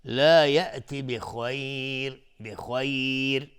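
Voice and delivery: slow, melodic, drawn-out chant; Quran recitation. Shown as a demonstration. Reciter chanting the whole verse slowly, melodic and devout. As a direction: let each phrase ring out, slow and devout.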